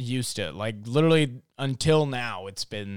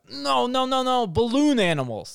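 frantic correction; 13 semitones higher, 4 dB louder than usual. Pretend. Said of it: "it'll be like".

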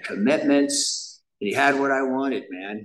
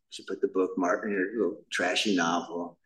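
ordinary talk, nothing marked

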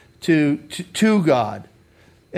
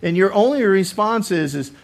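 ordinary talk, nothing marked

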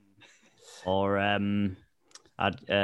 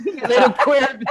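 high, laughing mock taunt; mock dad insult; 10 semitones higher, 4 dB louder, slower than usual. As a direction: tease in a squeaky put-on dad voice.

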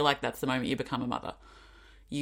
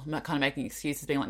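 ordinary delivery

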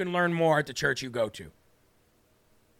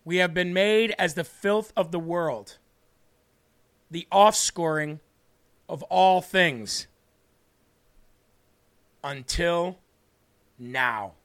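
measured verse, held back before the last words; deliberate rereading. Shown as a demonstration. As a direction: pause long, then set down the final words. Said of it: "Let me reread that last sentence".

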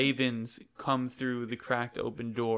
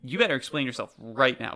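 loud, emphatic villain scolding; indignant castle owner laying down the law; angry confrontation; 6 dB louder than usual. Acted. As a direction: demand an explanation, hitting the words hard. Villain confronts intruder in her castle.

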